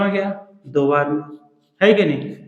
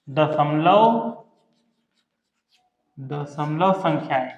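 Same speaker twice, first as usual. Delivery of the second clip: slow dictation; much slower than usual. Shown as a demonstration. Teacher dictates heading for notes.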